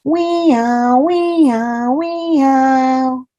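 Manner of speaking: high wailing wee-ow siren; siren noise. Pretend. Reading a picture book aloud, mimicking the siren. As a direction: wail it like a fire engine siren.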